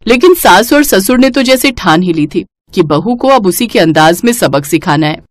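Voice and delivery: ordinary delivery, nothing marked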